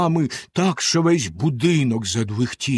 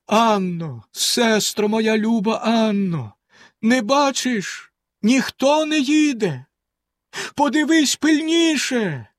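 much higher, slow desperate calling; 9 semitones higher, slower than usual. A narrator's reading. Wife calls her sister in despair, slow and high. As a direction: call out in despair. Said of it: "гукнула з розпукою".

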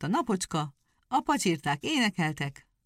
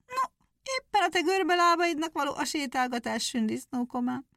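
high, scoffing hare voice; mocking hare; 9 semitones higher than usual. A narrator's reading. Hare taunts the hedgehog, high and scoffing. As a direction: scoff at his crooked legs. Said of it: "Nagyot nevetett erre a nyúl".

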